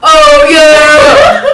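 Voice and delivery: loud laughing shout; mock football-game cheer. Co-host imitating college crowd at football game, rowdy cheer. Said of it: "at their football game".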